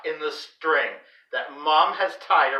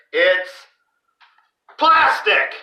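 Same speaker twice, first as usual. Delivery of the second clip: high, loud shocked cry; dad's furious shout at the plastic; 9 semitones higher, 5 dB louder, much slower than usual. A narrator's reading. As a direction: blurt it out in horror. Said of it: "Dad shouts".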